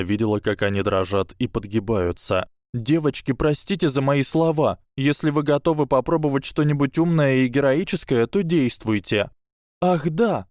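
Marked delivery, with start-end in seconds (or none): 2.7-9.3 put-on raised pitch for a young witch; high girl's voice. A narrator's reading. Susan, shaken, addresses the girls. 9.8-10.5 put-on high, trembling girl's voice. A narrator's reading. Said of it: "сказала Трейси Дэвис дрожащим голосом".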